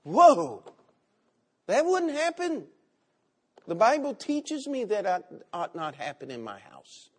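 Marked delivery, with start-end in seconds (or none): 0.0-2.7 high, loud disbelieving exclaim; loud, high mock shock; incredulous. Real, not acted. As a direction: react as if shocked. Preacher reacts to imagined child.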